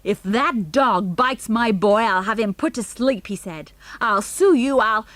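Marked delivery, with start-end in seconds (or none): 0.0-3.3 about to burst. A narrator's reading. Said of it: "as if he were about to burst". 4.0-5.0 loud, spluttering threat; about to burst. A narrator's reading. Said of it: "as if he were about to burst".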